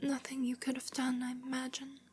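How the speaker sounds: put-on very soft, high, frail girl; faint and delicate, with weak pauses; sickly, feeble voice. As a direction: speak haltingly, fading almost to nothing. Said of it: "a sickly voice".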